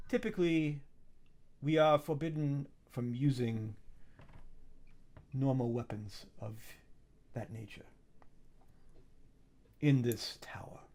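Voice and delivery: soft, hesitant formal refusal; lightly voiced keeper of the tower; measured tower mistress. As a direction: explain the rules softly, with long pauses. Game master voices tower's mistress.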